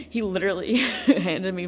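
amused laugh breaking into the words; real laughter mid-sentence; laughing while talking. Real, not acted. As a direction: laugh through the retelling. Laughs while telling the story.

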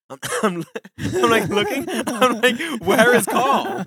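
high, loud, cracking up mid-story; laughing while telling. Real, not acted. Friend retelling his midnight scare to co-hosts, laughing. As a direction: tell it through bursts of laughter.

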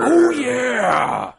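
high, loud, rasping "Oh yeah"; hammed-up Macho Man Randy Savage cry; Macho Man catchphrase. Shown as a demonstration. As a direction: growl it to the crowd. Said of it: "Let's hear it".

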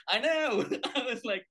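giggling through the words; laughing. Real, not acted. Laughing while telling a friend about the heckler.

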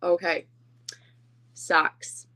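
usual speaking voice

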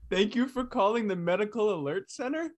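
high, laughing, incredulous repeat; laughing disbelief. Real, not acted. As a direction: echo it back in amused disbelief.

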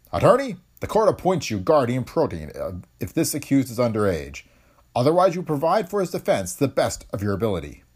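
a judge speaking from the bench, measured and formal; stern judge. A narrator's reading. Judge addressing the red-headed defendant, formal and stern.

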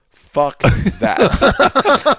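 high, loud burst of disbelief; incredulous refusal. Retold or imitated. Telling off the crew over their bear plan, incredulous.

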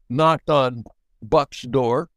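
ordinary delivery

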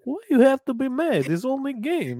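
high, mock Russian accent, broken English; Ilya Bryzgalov impression. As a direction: play the unbothered Russian goalie shrugging it off. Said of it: "I would act like is Ilya Bryzgalov".

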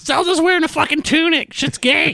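high, hammed-up kid voice; mocking kid voice. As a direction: sneer like a bratty kid.

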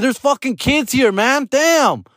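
high-pitched, acted outrage; retold angry yelling. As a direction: re-enact telling off a driver. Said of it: "I was mad".

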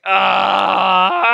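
short loud frustrated ah; frustrated shout. Retold or imitated. Host reenacting waking up after the loss with a shout. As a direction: let out a burst of frustration. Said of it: "that was my emotion".

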